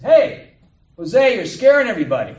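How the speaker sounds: big high shout across a shop; acted shout, very loud and high; calling out. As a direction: holler it at the boss. Customer calls out to the proprietor.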